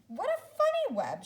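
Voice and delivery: high bright exclamation; amused exclaim. Acted. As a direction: squeal it with delight. Zoe's quoted thought, pig web.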